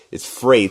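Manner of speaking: emphatic correction on "freight"; correcting pronunciation. Retold or imitated. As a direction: hit "freight" hard, rhyming with "late". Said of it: "you mispronounced it".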